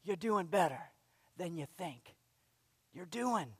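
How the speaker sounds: soft, low and gentle; lovingly. Shown as a demonstration. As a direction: ease it to a coworker like a kind friend. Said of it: "but just lovingly".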